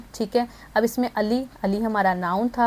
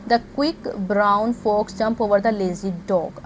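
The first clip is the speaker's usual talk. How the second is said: slow dictation; much slower than usual. Shown as a demonstration. Sentence read out for analysis.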